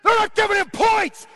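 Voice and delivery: high, loud outraged yell; full-volume sports rage; screaming fan. Retold or imitated. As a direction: scream at the referees. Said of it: "that's the tone of voice".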